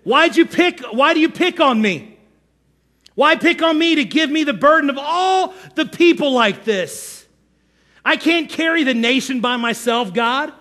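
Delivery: high, hammed-up pleading complaint; Moses as victim. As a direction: protest to God like a put-upon martyr. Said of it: "Moses says".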